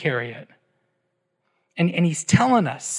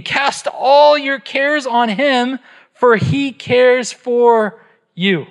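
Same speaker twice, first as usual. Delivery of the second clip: higher and slow, all stretched out; excited reading; 6 semitones higher, 3 dB louder, much slower than usual. Shown as a demonstration. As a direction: lift the room with each word. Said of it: "You guys, we should be excited".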